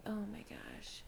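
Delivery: whispered exclamation; soft, unvoiced little exclamation; hushed reaction. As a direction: whisper it as a small retold reaction. Retold reaction to son.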